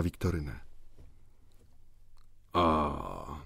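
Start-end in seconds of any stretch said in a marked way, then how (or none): 2.5-3.4 low, knowing 'oh, I see'; żartobliwie. A narrator's reading. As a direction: tease her with a lightly amused realisation. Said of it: "zawołał żartobliwie". (lekko żartobliwy ton)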